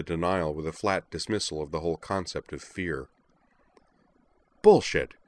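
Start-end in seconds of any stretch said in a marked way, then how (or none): 4.6-5.1 loud, sharp snap; angry snap. Acted. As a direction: bark the word out angrily. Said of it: "Utena snapped".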